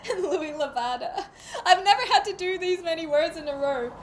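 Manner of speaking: high, loud, laughing complaint; cracking up. Real, not acted. Reader overwhelmed by the many names, high laughing complaint. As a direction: wail it through helpless laughter.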